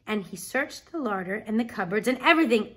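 plain reading voice

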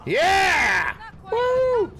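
loud, high victory whoop; triumphant cheer. Real, not acted. Gamer downing the mammoth, whoops loud and high. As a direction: cheer the kill.

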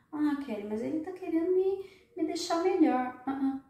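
put-on high whiny pleading girl voice; naive girlfriend. Naive woman defending her controlling boyfriend, high and whiny. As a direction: plead like she can't see it.

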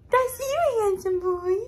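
high-pitched cooing. Real, not acted. Cooing at baby.

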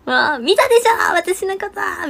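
teasing impression of the embarrassed bandmate; bashful friend. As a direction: mimic the shy friend fretting you saw her. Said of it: "みたいな".

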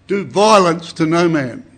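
loud, slow firm warning; stern command. Acted. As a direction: order the soldiers sternly. John commands the soldiers.

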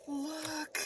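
high, very soft gasped wonder; gasp, then breathe it out high and soft; awed gasp. Real, not acted. Awed at the binder.

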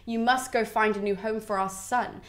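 calm, even instruction. Acted. Creusa's shade entrusts their son's future, very calmly. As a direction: instruct him gently, without any reproach. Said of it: "very calmly just looks at him".

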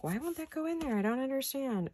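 high, puzzled mock whine; confused whine. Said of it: "You're like".

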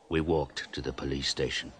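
ordinary delivery, nothing marked

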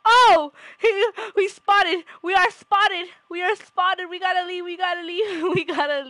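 high, loud hammed-up alarm; mock panic. Caught spying on the pet, mock panicked shouting. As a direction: yell that the cover is blown, half laughing.

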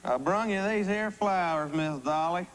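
lightly hammed country accent; cornball country accent. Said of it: "this cornball accent".

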